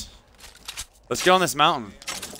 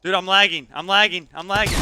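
hyped gamer bro; 6 semitones higher, 4 dB louder than usual. Real, not acted. Complaining about lag.

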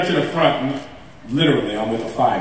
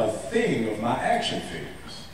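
normal talking voice

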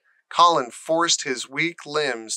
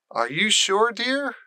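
higher, worried question; anxious; 8 semitones higher than usual. Acted. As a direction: fuss over him nervously. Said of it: "she asked anxiously".

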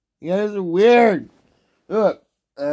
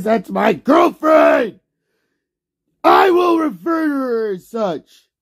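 insistent shout; 5 semitones higher than usual. Real, not acted. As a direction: hammer it home to chat. Shouting about girlfriend.

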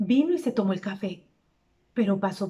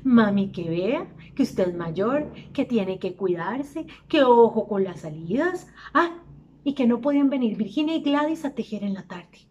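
nagging litany. Retold or imitated. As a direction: recite their warnings as a tiresome refrain. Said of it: "empezó la cantaleta".

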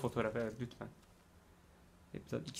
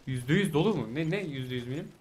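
usual speaking voice

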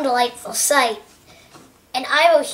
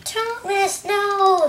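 high, drawn-out plea; pleading cry; 5 semitones higher, much slower than usual. Acted. Percy begging Thomas at the shredder, pleading cry.

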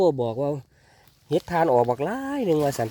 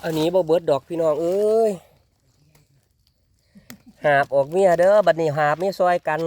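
louder, raised voice full of delight; excited; 4 semitones higher, 6 dB louder than usual. Real, not acted. Telling viewers about the haul, excited and louder. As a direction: call it out thrilled at the haul. Excited at big mushroom find.

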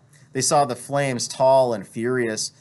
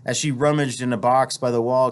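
ordinary delivery, nothing marked

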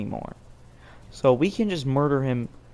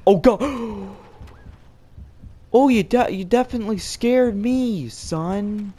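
mock tough, rattled; 5 semitones higher than usual. Admitting fright, mock tough.

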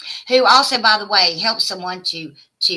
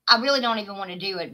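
usual speaking voice

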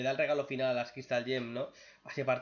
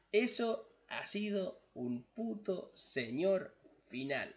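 emphatic rave; 6 semitones higher, slower than usual. Real, not acted. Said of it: "Eso ha sido un señor final".

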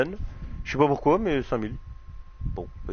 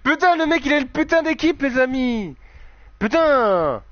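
excited; 9 semitones higher than usual. Real, not acted. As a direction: shout it out in delight. Celebrating a goal.

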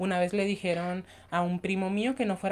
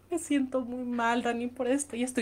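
whiny sick voice; 6 semitones higher than usual. Retold or imitated. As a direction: plead to your friend like a sick child. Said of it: "Y yo".

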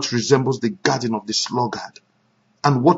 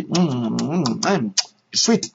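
put-on contented baby sounds; 4 semitones lower, 7 dB quieter, much slower than usual. Baby licking candy.

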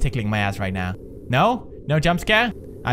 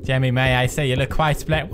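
normal talking voice

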